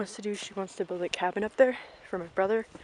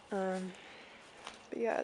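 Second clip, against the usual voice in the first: normal talking voice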